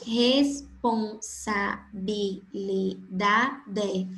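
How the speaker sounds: very slow, syllables pulled apart. Shown as a demonstration. Teacher breaking a long word into syllables, very slow. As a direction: stretch Responsabilidade into its seven syllables. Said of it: "Olha a responsabilidade".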